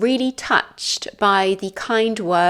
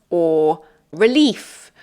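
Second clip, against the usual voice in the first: ordinary talk, nothing marked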